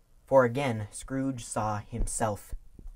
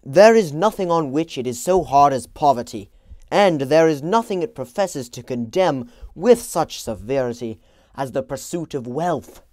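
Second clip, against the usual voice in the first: put-on loud, raised young Scrooge; bitter young man; 3 semitones higher, 12 dB louder than usual. A narrator's reading. Young Scrooge to his fiancée, loud and bitter. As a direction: declaim it like a man defending his greed.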